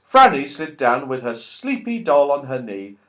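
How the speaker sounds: ordinary delivery, nothing marked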